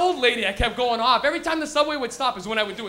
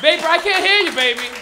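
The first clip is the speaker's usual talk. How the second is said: loud, raised rallying call; hyping the crowd; 4 semitones higher, 8 dB louder than usual. Real, not acted. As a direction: call out to rouse the audience.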